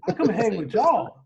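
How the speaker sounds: normal talking voice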